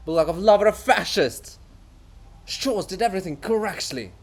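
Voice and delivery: high, loud, self-important rant; haughty author defending his greatness; pompous offended writer. Acted. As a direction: puff up with wounded vanity. Bulgakov bragging.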